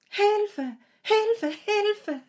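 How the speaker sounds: high, thin cries for help; shrill scream. Acted. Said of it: "Gellende Schreie hallten".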